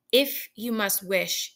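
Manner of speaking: slow, weighty demand; slow it down and insist. Acted. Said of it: "he demanded".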